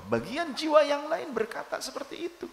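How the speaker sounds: high, loud, fast fervent preaching; preaching at full force. Acted. Preacher urging listeners on silent zikir, preaching at full force. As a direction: drive the point home with fire.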